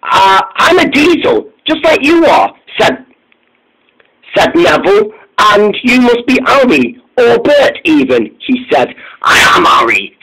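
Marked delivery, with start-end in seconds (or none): 0.0-8.3 Neville's voice. A narrator's reading. 9.2-10.2 Harry's voice. A narrator's reading.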